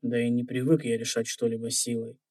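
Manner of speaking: ordinary delivery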